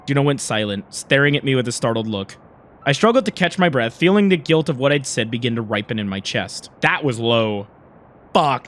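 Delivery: low, subdued first-person narration; story narration. A narrator's reading. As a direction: narrate the guilt low and restrained.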